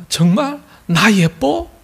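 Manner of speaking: high, slow, rising cutesy question; coy girlfriend. Acted. Seoul woman asking her boyfriend for praise, coyly. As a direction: fish for a compliment in mock girlish voice. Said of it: "그러자 서울 여자가".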